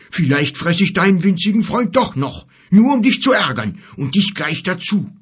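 loud, high, needling threat; angry bear. Acted. Bear threatening to eat the giant's friend, angry. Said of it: "wackelte zornig mit dem Kopf".

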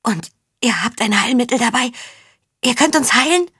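high voice climbing with hope; pleading little man. A narrator's reading. Small sick man hopes for cure. (flehend, immer höher werdend)

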